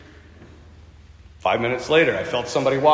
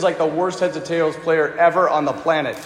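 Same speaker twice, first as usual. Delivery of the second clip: ordinary delivery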